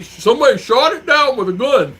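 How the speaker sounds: high, loud mock-indignant protest; retold outrage. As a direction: exclaim it in imitated disbelief. Retells friend's outraged answer.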